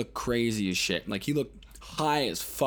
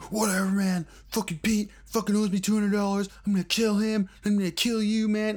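high, louder ranting threat; crazed threat; 9 semitones higher, 4 dB louder than usual. Retold or imitated. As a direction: rant like a wired junkie out for blood. Crackhead threatens, voiced by teller.

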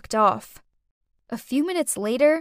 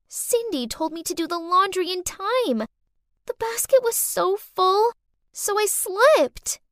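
put-on manipulative little girl, plaintive; 8 semitones higher than usual. A narrator's reading. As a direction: play the poor little victim. Child figure in the story.

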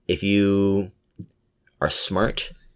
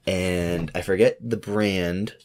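ordinary talk, nothing marked

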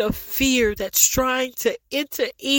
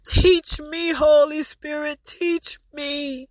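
higher, voice breaking into crying; tearful plea; 3 semitones higher than usual. Real, not acted. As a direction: beg to be taught through tears. Tearful plea to Holy Spirit.

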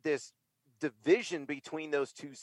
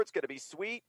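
usual speaking voice

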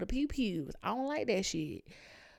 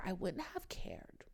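whispered, slower and softer; 10 dB quieter than usual. Retold or imitated. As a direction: drop to a secretive whisper. Hushed aside in retold talk.

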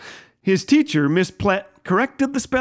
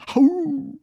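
a high, long imitated dog howl; 9 semitones higher than usual. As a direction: howl like a tiny puppy. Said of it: "mournful howl".

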